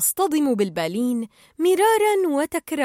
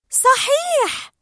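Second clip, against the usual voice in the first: very high, drawn-out squeal; squeaky, emphatic yes; enthusiastic agreement; 5 dB louder, much slower than usual. A narrator's reading. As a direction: stretch it out high and gleeful. Dory agrees with gusto.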